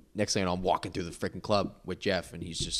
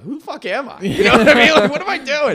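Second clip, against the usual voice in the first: much higher and louder, laughing at himself; self-mocking disbelief; 16 dB louder than usual. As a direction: squeal in disbelief at your own nerve.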